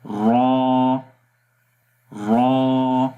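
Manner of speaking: را said thick with a rolled r; heavy ra demo. Shown as a demonstration. Teacher repeats a heavy ra for students. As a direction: model a full را again. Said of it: "اس کو دوبارہ پڑھیں گے".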